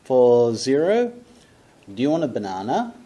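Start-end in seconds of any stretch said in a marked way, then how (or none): 1.9-2.9 quick, run-together, "Do you" blurred to "ti"; fast reduced model sentence. Shown as a demonstration. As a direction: rattle "Do you want a banana?" off fast and linked like natives. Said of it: "listen and repeat".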